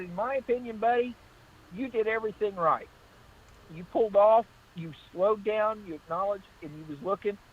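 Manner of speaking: earnest praise. Retold or imitated. Officer praising the careful driver, high and animated. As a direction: build him up, enthusiastic and high.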